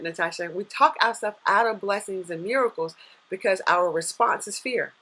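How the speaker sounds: normal talking voice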